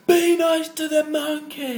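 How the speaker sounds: high, drawn-out retold shout. Onlooker shouting at monkey's handler, loud high retold outrage. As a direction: bellow a slow, high-pitched plea. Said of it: "I shouted at him going".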